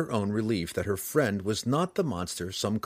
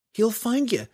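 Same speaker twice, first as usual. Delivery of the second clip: put-on much higher girl's voice, grim warning; girl's voice, grim and certain; grimly; 13 semitones higher than usual. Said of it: "Canterbury replied grimly".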